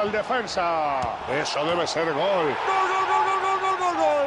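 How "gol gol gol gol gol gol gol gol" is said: screaming GOL over and over, high; breakneck goal commentary. Acted. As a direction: ecstatic goal call, pitch way up. Goal scored in match commentary.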